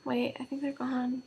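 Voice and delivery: hushed whisper; whisper, afraid to jinx it. Real, not acted. Hushed check if hiccups stopped.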